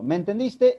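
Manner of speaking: "¿Me entendiste?" high with a rising end; tono ascendente. Shown as a demonstration. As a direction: ask "¿Me entendiste?" as a lifted yes-no question. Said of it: "es un tono ascendente". ("¿Me entendiste?" en tono alto, subiendo al final)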